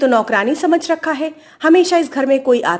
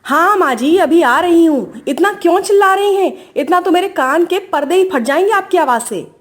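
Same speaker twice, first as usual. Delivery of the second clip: higher, exasperated protest; annoyed answering back; 3 semitones higher than usual. Acted. Daughter-in-law complains of mother-in-law's ear-splitting voice, annoyed. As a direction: call back, irritated at the yelling.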